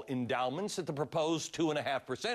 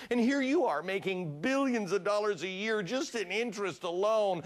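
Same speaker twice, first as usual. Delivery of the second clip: mock pleading; 5 semitones higher, 4 dB louder than usual. Mock plea for poor colleges.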